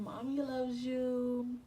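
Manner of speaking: put-on loving singsong to a baby bump; tender cooing. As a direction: coo it to your unborn baby, lingering on each word. Voicing herself talking to unborn baby.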